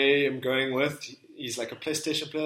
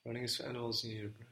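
very quiet, murmured recap; softly thinking aloud; pondering to himself; 12 dB quieter, much faster than usual. Real, not acted. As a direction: mull it over under your breath. Guesser repeats quote to himself.

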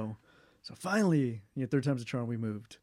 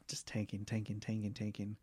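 muttered whisper; 8 dB quieter than usual. Real, not acted. Blind student telling interviewer about failing, whispered. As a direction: breathe it out like a private groan.

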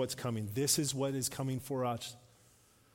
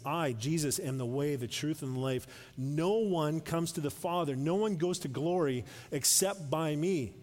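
raised, ringing scripture reading; preaching scripture; 3 semitones higher, 3 dB louder than usual. Acted. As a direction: proclaim it to the congregation.

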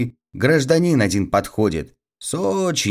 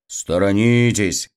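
usual speaking voice

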